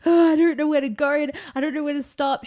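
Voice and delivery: high, very loud re-enacted panic; frantic retold wail; freaking out. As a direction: act out losing it on the phone. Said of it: "And I'm freaking out".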